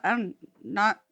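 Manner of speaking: usual speaking voice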